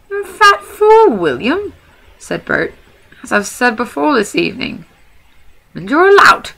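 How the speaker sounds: high, loud troll jeering; insulting troll. Acted. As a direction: hurl the insults at him. Bert insults William.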